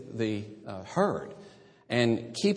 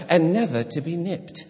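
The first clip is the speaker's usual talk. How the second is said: mock English accent; 6 semitones higher than usual. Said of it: "It just sounds like an English person wrote that".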